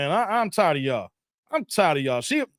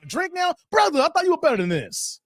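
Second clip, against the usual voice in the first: usual speaking voice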